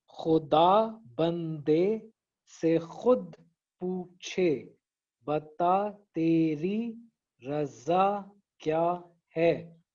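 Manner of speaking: very slow, खु ... दा ... बन ... दे; syllable by syllable. Shown as a demonstration. Teacher counting syllables in the second line, syllable by syllable. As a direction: space out each syllable through to है. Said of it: "कितने syllables बने".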